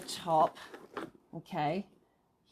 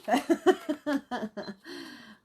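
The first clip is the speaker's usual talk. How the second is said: giggle; 5 semitones higher, 5 dB louder than usual. Real, not acted. Laughs at the machine.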